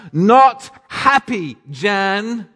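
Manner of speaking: high, loud, slow mock ad line; quoting the old TV ad with punch; ad catchphrase. Preacher quoting the ad to the congregation, mock indignant. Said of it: "If you remember the ad".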